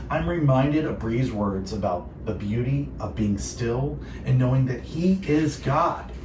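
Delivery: ordinary delivery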